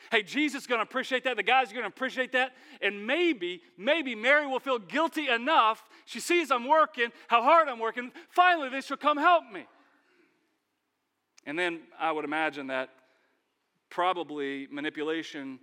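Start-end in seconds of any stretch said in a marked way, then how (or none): 0.1-9.6 she'll notice me, getting worked up; building hopeful excitement. Acted. Martha talking herself up about her hard work, rising excitement. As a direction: voice climbing higher and louder.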